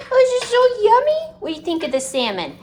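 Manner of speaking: very high-pitched pet voice. Real, not acted. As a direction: squeal it sweetly to the dog. Baby talk to her dog.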